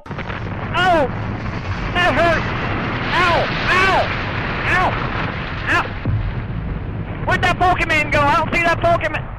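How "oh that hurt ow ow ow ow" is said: higher hurt yelps; pained ow. Acted. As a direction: yelp ow after a fall. After the crash landing.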